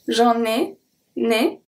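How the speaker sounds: j'en ai split to show the N landing on né; liaison shown. Shown as a demonstration. Teacher isolating the linked N. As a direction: stress the né so the N of en is heard moving over. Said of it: "the N from en is going to be transferred to e".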